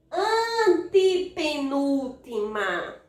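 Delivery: slow, drawn-out teaching voice; syllable by syllable. Shown as a demonstration. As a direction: stretch each syllable of "antepenúltima". Teacher counts syllable positions. (sílaba por sílaba, bem devagar)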